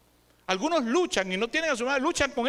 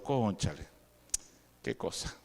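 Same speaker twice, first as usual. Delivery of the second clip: muttered aside; 14 semitones lower, 8 dB quieter than usual. Real, not acted. Aside in lowered voice.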